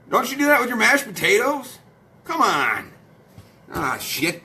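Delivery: normal talking voice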